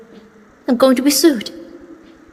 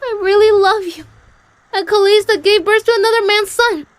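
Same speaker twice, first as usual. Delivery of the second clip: pitched up, hurt and demanding; high pleading; 8 semitones higher, 3 dB louder than usual. Acted. Queenie jealously pleading with Jeremiah, high and hurt. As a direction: throw the jealousy at him, high.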